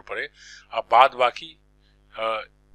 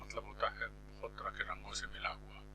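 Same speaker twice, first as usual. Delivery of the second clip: whisper; 3 semitones lower, 16 dB quieter than usual. Real, not acted. Teacher glosses a word.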